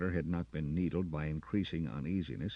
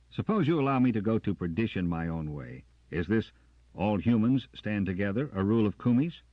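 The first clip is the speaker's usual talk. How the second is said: louder challenging sergeant; defiant retort; 6 semitones higher, 9 dB louder than usual. Acted. Carter confronting Jackson, loud and defiant. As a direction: demand the truth defiantly.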